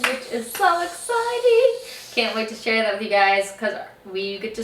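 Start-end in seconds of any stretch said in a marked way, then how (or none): 0.5-1.9 excited. Real, not acted. Announcing first doctor's appointment.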